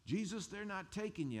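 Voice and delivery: high, urgent cry of defiance; Peter's outburst. Retold or imitated. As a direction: shout it like a desperate disciple. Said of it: "I could just imagine".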